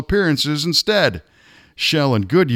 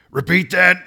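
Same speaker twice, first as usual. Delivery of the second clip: higher-pitched, insistent demand; urgent demand; 7 semitones higher than usual. A narrator's reading. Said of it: "he demanded".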